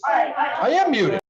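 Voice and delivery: high, slow, loud protest; indignant shout. Real, not acted. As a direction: insist loudly, drawn out. Insisting over a bad call.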